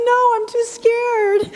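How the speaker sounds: high, mock-frightened squeak; too scared. Retelling her younger self refusing the audition, mock-frightened high voice. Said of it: "I'm like".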